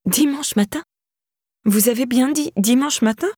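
high, startled double-check; alarmed rising question; stunned disbelief. A narrator's reading. Shock at the time of death.

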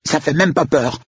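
put-on high-pitched boyish boast; high little show of bravery; child's bravado. A narrator's reading. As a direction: act unafraid, like a defiant kid. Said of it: "comme une bravade".

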